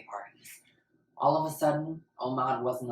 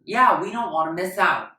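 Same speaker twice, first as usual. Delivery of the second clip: higher, louder eager voice; eager agreement; 7 semitones higher, 9 dB louder than usual. Acted. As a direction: cheer him onto the team. Kid cheers joining in.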